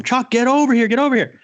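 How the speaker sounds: high, loud yell across the ice; frantic high yell to a fishing buddy; screaming for him. Retold or imitated. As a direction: scream for him to come over. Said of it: "I'm screaming for him".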